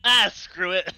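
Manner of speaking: mock dismissive. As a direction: toss it off like you've given up, loud. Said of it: "and I was like".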